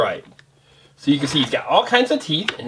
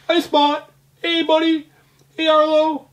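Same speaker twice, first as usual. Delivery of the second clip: put-on much higher, sweet baby-talk hello; cutesy greeting; 11 semitones higher than usual. Parent greets small toy Spot, high cooing voice. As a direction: coo at the little toy.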